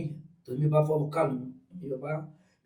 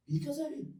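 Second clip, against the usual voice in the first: brother's voice; 12 semitones higher, 9 dB quieter than usual. Retold or imitated. Brother's retold question.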